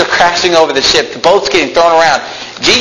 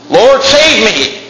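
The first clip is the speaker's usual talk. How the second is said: drawn-out cry; 6 semitones higher, much slower than usual. Retold or imitated. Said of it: "he cries out".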